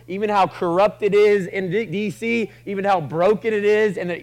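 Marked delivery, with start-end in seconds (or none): none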